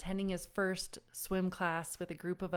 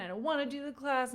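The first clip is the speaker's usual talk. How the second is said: put-on whiny child; 6 semitones higher, faster than usual. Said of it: "whiny and mopey".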